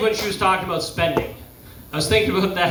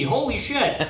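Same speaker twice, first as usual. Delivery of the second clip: ordinary talk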